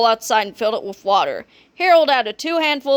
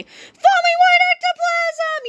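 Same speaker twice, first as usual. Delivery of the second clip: very high, slow shrieking; terrified yell; 4 dB louder, slower than usual. A narrator's reading. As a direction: scream it out as you flee. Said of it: "yelled the cheerleaders".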